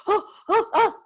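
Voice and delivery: high, loud "oh" cries; hammed-up high-pitched alarm; mock panicked gasps. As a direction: act out gasping at hot pepper. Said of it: "We thought the world was going to come to an end".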